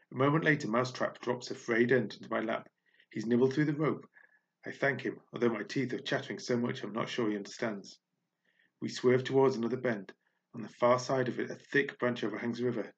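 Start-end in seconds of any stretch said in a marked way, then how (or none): none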